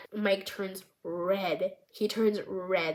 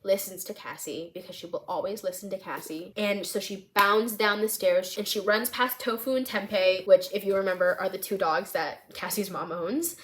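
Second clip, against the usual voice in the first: plain reading voice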